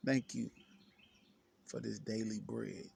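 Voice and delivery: soft, low thanksgiving; quiet prayer. Real, not acted. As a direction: give thanks softly and low, as in private prayer.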